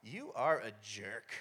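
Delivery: low, slow, soft grudge; mock resentment. Teen silently judging his dad, mock resentment. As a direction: drop it slowly like a private verdict, hammed up.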